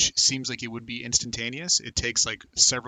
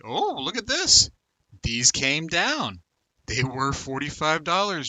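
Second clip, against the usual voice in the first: much higher and louder, drawn out slowly; excited surprise; 5 semitones higher, 6 dB louder, slower than usual. Real, not acted. Spotting that the coins came down in price, loud, slow and delighted. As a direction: gasp it out as if you just spotted a bargain.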